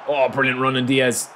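usual speaking voice

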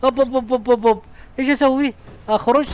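صوت عالٍ مذعور من علوق الشخصية. Real, not acted. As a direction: yelp up high as the character gets stuck. الشخصية علقت فجأة، ذعر.

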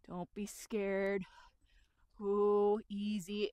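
slow, timid coaxing; nervous, slow reassurance; timid handler. Acted. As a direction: tentatively soothe a horse, drawn out. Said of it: "Other people will come in too timid".

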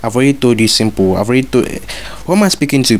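ordinary delivery

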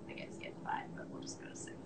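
very soft breathy whisper; whispered aside. Real, not acted. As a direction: whisper it to yourself. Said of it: "whispered aside".

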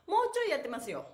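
normal talking voice

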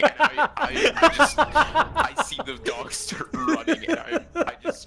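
laughing hard while telling it. Real, not acted. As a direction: crack up through the story. Laughing retelling the chase.

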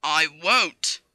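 loud, low, defiant; stubborn refusal. Acted. Passenger refusing to give up bootlace, defiant. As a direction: refuse flatly at volume.

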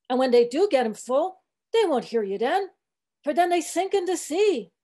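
put-on aggrieved old cook, lilting protest; old ship's cook in dialect. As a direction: preach wearily in the cook's dialect. Fleece voiced in dialect, protesting to Stubb.

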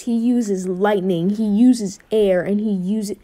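ordinary delivery

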